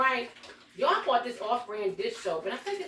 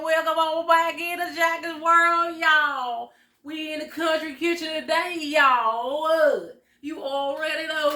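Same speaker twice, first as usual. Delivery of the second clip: higher, louder, slow welcoming host voice; show host voice; 5 semitones higher, 4 dB louder than usual. Acted. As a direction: roll out a big country-kitchen welcome. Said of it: "Oh, let me get into the voice".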